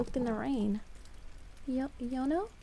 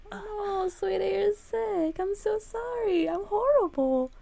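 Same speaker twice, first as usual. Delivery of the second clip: high, slow, tearful pleading; distraught; 8 semitones higher, 4 dB louder, slower than usual. Acted. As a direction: fret over him on the verge of tears. Heroine pities sick Yono.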